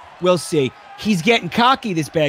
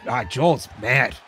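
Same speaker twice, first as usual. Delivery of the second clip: ordinary talk, nothing marked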